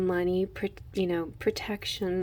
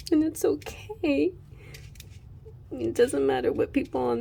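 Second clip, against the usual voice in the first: high, tearful voice on the edge of crying; choked-up and moved, fighting tears; teary; 6 semitones higher than usual. Real, not acted. Reader passing on the baby's love, voice breaking. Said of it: "I'm about to cry".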